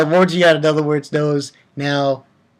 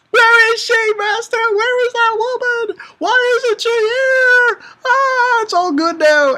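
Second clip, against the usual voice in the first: much higher, pleading desperate voice; desperate Alibaba; 3 dB louder than usual. Acted. Alibaba begging his master, high and desperate. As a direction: beg like a heartbroken young man.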